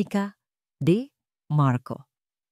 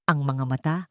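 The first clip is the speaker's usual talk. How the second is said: ordinary delivery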